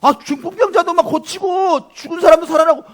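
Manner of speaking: excited, high, loud crowd member; loud, high-pitched excited calling; someone shouting out. Acted. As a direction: call out as if spotting someone famous. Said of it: "누가 소리친 거예요".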